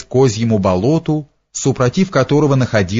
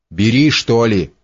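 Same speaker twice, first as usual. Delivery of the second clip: ordinary delivery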